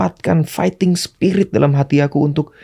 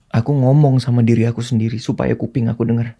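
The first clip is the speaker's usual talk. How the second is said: usual speaking voice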